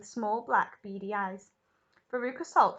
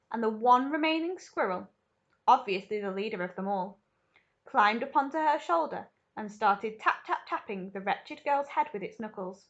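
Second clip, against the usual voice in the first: ordinary delivery, nothing marked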